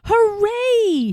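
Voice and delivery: high, triumphant cheer; the king cheering at his great height; shouted hooray. A narrator's reading. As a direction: crow it out from the top of the stack. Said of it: "shouted Yertle".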